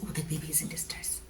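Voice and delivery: quick, low whisper as the doctor; hushed bad news. Doctor telling mother about the distressed baby, hushed. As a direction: imitate a doctor's hushed, hurried murmur.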